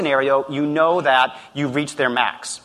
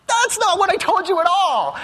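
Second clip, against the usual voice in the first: much higher, incredulous outburst; exasperated disbelief; 13 semitones higher than usual. Retold or imitated. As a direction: throw up your hands in disbelief. Boss exasperated at Kenny's blunder.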